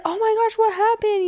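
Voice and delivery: a high, exaggerated imitation of her own worry; mock concern. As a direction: show how worried you were. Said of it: "I said".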